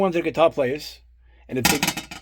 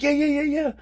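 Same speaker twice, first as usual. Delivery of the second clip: rapid yeahs pitched way up; mock-eager friend, much higher; 12 semitones higher than usual. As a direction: voice the friend jumping at the chance. Friend eagerly agrees to meet Zakk.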